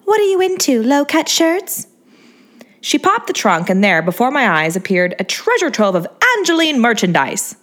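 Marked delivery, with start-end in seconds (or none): 0.0-1.9 put-on high, sweet baby-doll voice; bubbly celebrity voice, pitched way up; Angeline's girly voice. A narrator's reading. To the narrator, a high, sweet starlet voice.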